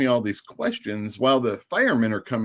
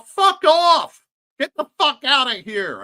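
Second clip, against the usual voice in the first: much higher, loud hammed-up yell; comic mock yell; 6 dB louder than usual. Chasing off a woman filming the scene, hammed-up yell. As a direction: blow up at her, comically overdone. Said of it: "maybe not as comically as I did just now".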